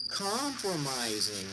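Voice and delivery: slow, drawn-out big reveal; dramatic drum-roll reveal. Shown as a demonstration. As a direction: stretch it like a game-show reveal. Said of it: "drum roll".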